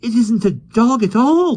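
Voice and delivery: put-on high, loud girl's cry; cried out. A narrator's reading. Jane cries out to the others, high and loud. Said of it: "cried Jane".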